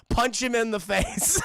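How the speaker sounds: loud slow shout with a laugh. Retold or imitated. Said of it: "Bruce is just in his ear".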